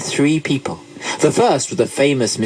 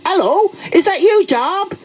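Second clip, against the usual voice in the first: high-pitched, broad Devon greeting; wild Devon accent; 3 dB louder than usual. A narrator's reading. Man accosting Job in the street, wild Devon accent. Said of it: "said in a wild Devon accent".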